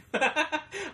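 sudden high burst of laughter; real high laugh. Real, not acted. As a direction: crack up at yourself. Laughs at own memory.

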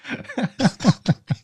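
talking through laughter; giggling words; laughing. Real, not acted. Laughing at the impression.

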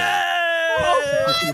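loud cheer; excited shout. Real, not acted. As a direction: yell it out in delight. Cheering a rating of ten.

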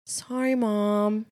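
put-on slow, drawn-out kid apology; sheepish little boy. Mom imitating her son's slow guilty apology. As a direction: sound caught out and sorry.

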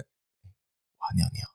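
slow, soft whisper broken by a laugh; slow mock whisper in the ear. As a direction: murmur it into an ear, giggling. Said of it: "在耳边".

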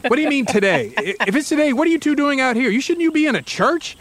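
mild mock shout climbing in pitch; shouting back. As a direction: yell through the gate at the intruders. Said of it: "the homeowner shouts back through the gate".

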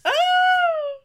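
sudden high delighted oh; excited gasp. Real, not acted. Host thrilled her friend found the zine, delighted squeal. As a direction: squeal of surprise at a find.

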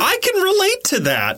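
high, put-on voice with a sly edge; mock sarcastic reply. Answering Melinda about the lazy coworker, high and sly. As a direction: squeak it like a cheeky confession. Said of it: "And I was like".

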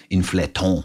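put-on inflaton in a joking French accent; mock French accent. Host jokingly answering the pronunciation question, mock French accent. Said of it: "It's French, so it's".

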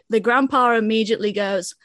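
ordinary delivery

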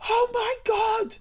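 very high, slow, starstruck shriek; girl in Covent Garden screams at spotting Mo, high and drawn out; high-pitched fan squeal. Retold or imitated. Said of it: "This girl just started screaming".

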